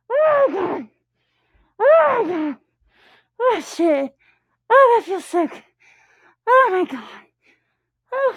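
high rising moans of release; moaning. Real, not acted. Reaching climax.

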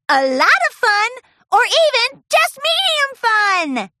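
high, loud bubbly pony voice; excited invitation. Acted. Pinkie inviting the busy crowd, high excited calling out. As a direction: announce the party to everyone.

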